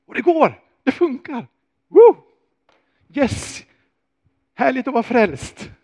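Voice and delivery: loud, high, whooping joy; thrilled Peter. Acted. Peter walking on water, shouting high with joy. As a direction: cheer like a man who just walked on water.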